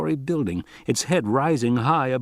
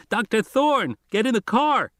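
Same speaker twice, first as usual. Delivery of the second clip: put-on much higher, scratchy boy's voice calling over the radio; a kid on the radio, urgent and high; insistent high scratchy boy on the line; 11 semitones higher than usual. Arby on the radio.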